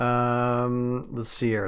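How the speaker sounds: ordinary talk, nothing marked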